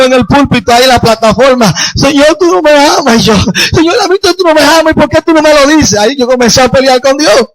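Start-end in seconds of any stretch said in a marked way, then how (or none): 1.9-6.0 high-pitched protest to God; re-enacted plea, voice pitched up; aggrieved pleading. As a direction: complain like a hurt, jealous child of God. Replays his complaint to God.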